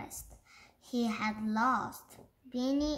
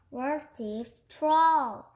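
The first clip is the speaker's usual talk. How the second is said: ordinary delivery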